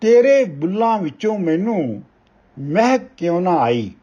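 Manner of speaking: measured, weighty reading of a Punjabi poem; ਕਵਿਤਾ ਪਾਠ ਦੀ ਠਹਿਰਾਵ ਵਾਲੀ ਅਵਾਜ਼; poem recitation. Acted. Poet recites poem Hussar.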